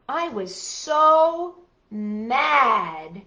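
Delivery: very slow, stretched "so ... mad"; milk each word of the title, slowly; exaggerated title reading. A narrator's reading. Repeats title more dramatically.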